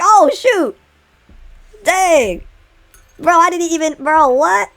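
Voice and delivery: high, stunned exclamations of disbelief; shocked disbelief. Real, not acted. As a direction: react like the ground just fell away. Reacting to friend's interpretation.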